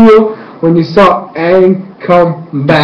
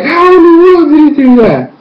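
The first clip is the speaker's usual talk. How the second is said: high, incredulous fan outburst; re-enacted amazement; 11 semitones higher, 4 dB louder than usual. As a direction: marvel aloud in disbelief. Replays amazed reaction to show.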